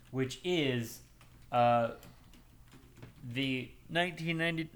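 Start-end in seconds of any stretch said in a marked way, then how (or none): 3.3-4.7 talking through hiccups; words broken by hiccups. Real, not acted. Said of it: "I'm hiccuping".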